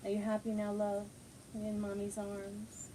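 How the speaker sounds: quiet pet talk, very tender; tender murmur to the dog. Real, not acted. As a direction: gently reassure the pup in your arms. Soft talk to dog in arms.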